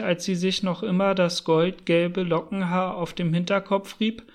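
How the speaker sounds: plain reading voice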